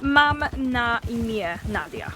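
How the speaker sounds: "Mam na imię Nadia" said slowly, each Polish syllable clear; Polish demo. Shown as a demonstration. Polish speaker teaching friends how to say her name slowly. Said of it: "repeat that".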